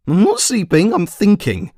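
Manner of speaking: put-on high, loud, flustered old wizard; startled wizard. Wizard caught dozing by the commander, high flustered protest. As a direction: squeak out the excuse, caught napping. Wizard jerked awake, defensive.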